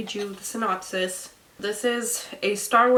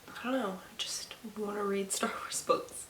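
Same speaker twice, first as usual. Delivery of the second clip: breathy whisper aside; 11 dB quieter than usual. Real, not acted. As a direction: whisper it to yourself. Wistful aside to camera.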